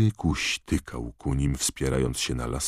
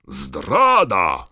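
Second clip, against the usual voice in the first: much louder, higher roar; 4 semitones higher, 8 dB louder than usual. A narrator's reading. Karkarov accusing Dumbledore of treachery, roar. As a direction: bellow the accusation. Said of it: "ryknął".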